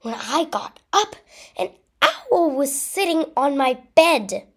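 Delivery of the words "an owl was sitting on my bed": plain reading voice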